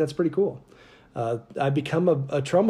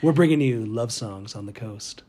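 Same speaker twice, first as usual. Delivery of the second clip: late night FM DJ voice; slower than usual. Shown as a demonstration. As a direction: ease into a lulling DJ pitch. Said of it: "Yes, just like that".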